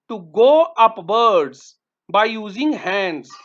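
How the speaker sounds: slow, careful reading of the meaning; slow dictation. Shown as a demonstration. Teacher reading a word meaning to pupils, slow. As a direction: read it slowly so children follow. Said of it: "teacher reading meaning".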